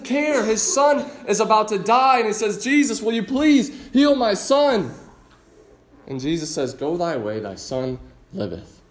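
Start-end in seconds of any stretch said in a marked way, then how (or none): 2.6-4.9 higher and slow, begging; pleading request. Retold or imitated. Father begging Jesus to heal his son, pleading and higher. 6.7-8.8 gentle grace. Acted. Jesus gently telling the father his son lives, soft and tender.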